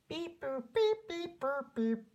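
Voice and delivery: high electronic beep boop; robot beeps. Acted. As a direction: chirp like a little robot. Narrator imitates robot egg.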